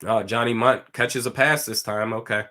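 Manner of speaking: ordinary talk, nothing marked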